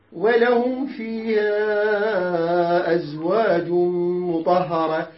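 very slow chanted verse with long vowels; Quran recitation. Shown as a demonstration. Teacher reciting the verse to the class. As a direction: recite it slowly, stretching each vowel. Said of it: "نقول ولهم".

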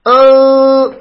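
أن held long and high through the nose; over-pressed nasal noon. Shown as a demonstration. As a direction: press hard on the nose for a drawn-out أن. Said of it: "خطأ".

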